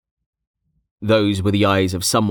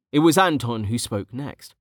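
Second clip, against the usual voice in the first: usual speaking voice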